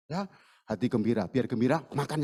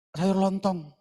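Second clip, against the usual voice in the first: sung out loud and high like a street hawker; vendor's call; 7 semitones higher, 6 dB louder, much slower than usual. Retold or imitated. Street vendor calling out wares.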